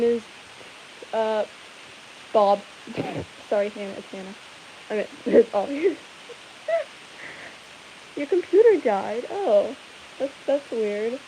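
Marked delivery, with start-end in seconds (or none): none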